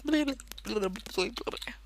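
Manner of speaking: lazy relaxed blah sounds, lightly done; floppy relaxed babble. Retold or imitated. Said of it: "I make those sounds when I get relaxed".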